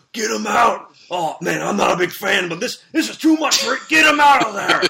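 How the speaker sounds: high, loud gravelly-free wrestling announcer yell; Macho Man shouting. Retold or imitated. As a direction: bellow it like a hyped commentator. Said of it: "They're like".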